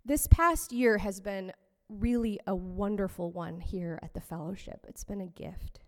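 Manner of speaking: usual speaking voice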